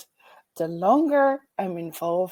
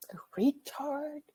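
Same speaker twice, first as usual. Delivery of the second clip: whispered; 8 semitones higher, 6 dB quieter, slower than usual. Real, not acted. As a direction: drop to a whisper as if it shouldn't be said. Hushing an offensive word.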